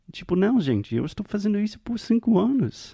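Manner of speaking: exasperated protest. Real, not acted. To her partner, protesting she has no excuses, exasperated. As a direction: throw up your hands and protest you've done it for years.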